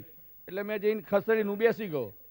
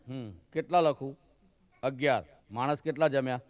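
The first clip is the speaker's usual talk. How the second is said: a lower gruff cop voice; low gruff constable; 4 semitones lower than usual. Retold or imitated. Constable demanding a bigger gift, lower gruff voice. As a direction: play the stern constable, lower.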